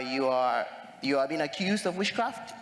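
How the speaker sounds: ordinary delivery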